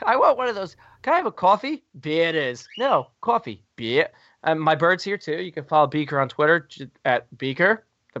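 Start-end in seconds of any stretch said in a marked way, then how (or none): 0.1-3.6 much higher squeaky pet-bird voice; cartoon bird asking for coffee. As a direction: chirp it like a little parrot.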